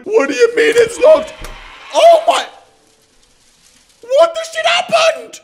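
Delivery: very high, loud alarmed yelling; shocked shout. Real, not acted. Streamer finding the store trashed, loud high alarmed shout. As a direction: yell it in real disbelief.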